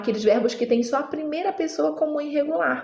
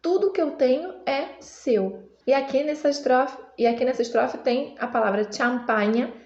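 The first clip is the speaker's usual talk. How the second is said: usual speaking voice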